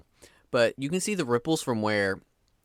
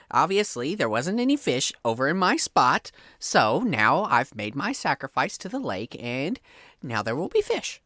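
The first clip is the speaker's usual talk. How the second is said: ordinary delivery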